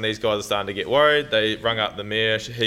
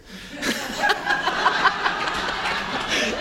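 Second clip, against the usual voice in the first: breathy laugh. Real, not acted. Laughs at her own joke.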